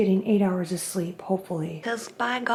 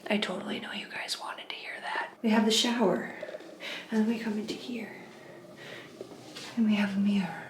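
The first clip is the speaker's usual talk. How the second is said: whispering; 8 dB quieter than usual. Real, not acted. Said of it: "really quiet".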